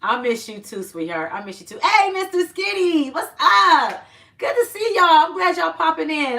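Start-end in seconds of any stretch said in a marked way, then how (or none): 1.8-6.4 excited greeting. Real, not acted. Greeting viewers in live chat.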